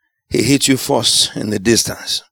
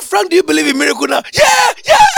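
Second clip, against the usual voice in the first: high, fast, loud exchange; joyful shout; 5 dB louder, faster than usual. Retold or imitated. Preacher asks Frank about miracles, loud joyful shout. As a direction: fire it off in delight.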